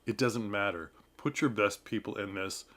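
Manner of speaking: ordinary talk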